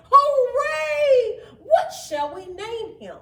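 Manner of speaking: very high, loud cheer; joyful shout. A narrator's reading. Children cheering the finished snowman, shouting with joy. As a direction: burst out cheering. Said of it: "asked Tracy".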